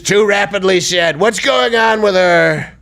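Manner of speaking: puzzled Trump voice; Trump impression. Host reads Trump's post in Trump voice. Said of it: "Can you say the last one".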